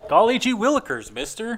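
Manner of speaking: put-on high, fast golly-gee kid voice; wholesome 1950s kid. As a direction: chirp it like an old-time kid.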